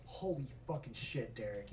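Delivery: low, hushed aside to Derek; acted quiet dismay; under-breath alarm. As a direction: say it softly, as if the coaches mustn't hear. Urging Derek under his breath.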